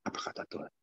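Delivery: hushed whisper. Acted. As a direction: breathe it in a whisper. Hushed words of God.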